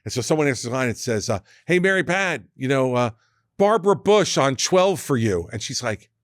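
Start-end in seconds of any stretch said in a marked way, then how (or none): 1.6-5.5 raised, high-pitched call to a coworker; imitated office holler. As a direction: announce a phone call across a busy office. Colleague calls across trading desk.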